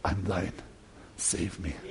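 desperate prayer. Acted. Luther on the cell floor prays, barely audible. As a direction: breathe the prayer out, barely voiced. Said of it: "He lies on the floor".

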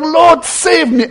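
slow, high, drawn-out cry for help. Acted. Failing Peter cries out to Jesus, slow high plea. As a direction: stretch it out high and pleading. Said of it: "he cries out".

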